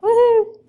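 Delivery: high, loud woohoo cheer. Real, not acted. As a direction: whoop for the winner. Cheering the winner.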